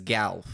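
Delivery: "Galf" with a flat a for golf; mispronounced golf. Retold or imitated. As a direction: say golf as "Galf", rhyming with Ralph. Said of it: "saying words wrong".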